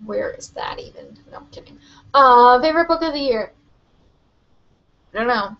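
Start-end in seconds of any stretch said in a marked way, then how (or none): none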